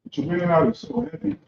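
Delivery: normal talking voice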